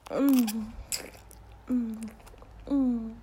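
loud moan. Acted. Moans in intimate scene.

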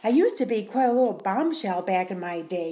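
ordinary talk

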